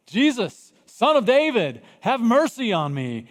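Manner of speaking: high, loud desperate call; crying out. Acted. Bartimaeus calling to Jesus, loud desperate cry. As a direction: shout for mercy without restraint. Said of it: "starts crying out".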